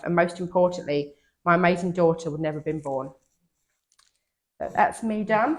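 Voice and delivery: ordinary delivery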